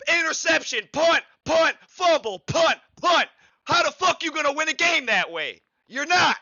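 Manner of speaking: exasperated fan yelling; furious yell. Real, not acted. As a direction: blow up at the list of failures. Furious rant listing failed drives.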